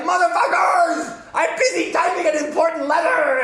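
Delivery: high, loud mock-furious yell; hammed-up indignant scream; outraged shout. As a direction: explode at attackers for interrupting you. Interrupted by attack while typing.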